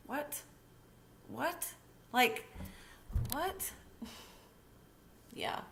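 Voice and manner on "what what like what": high, stunned repeated what; baffled high-pitched what; incredulous what. Real, not acted. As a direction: squeak out disbelief. Stunned disbelief at crash news.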